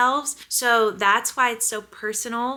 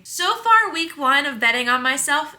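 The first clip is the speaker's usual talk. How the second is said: much higher and louder burst; excited; 5 semitones higher, 6 dB louder than usual. Real, not acted. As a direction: squeal it out with joy. Gushing about first week.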